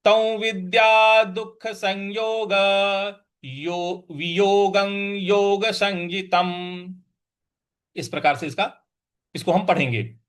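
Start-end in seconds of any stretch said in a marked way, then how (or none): 0.0-6.9 slow full verse line, chanted; slow recitation. Shown as a demonstration. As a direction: recite the whole line slowly and evenly. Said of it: "तो ये बन जाएगा".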